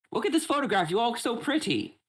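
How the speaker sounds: high, bright showman calling out to contestants; game show host voice. Acted.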